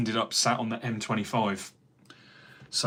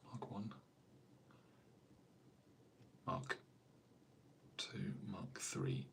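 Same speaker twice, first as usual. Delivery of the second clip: murmured counting; 19 dB quieter, slower than usual. Real, not acted. Counting models under breath.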